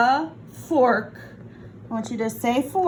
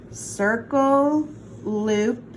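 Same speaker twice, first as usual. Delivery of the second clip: ordinary delivery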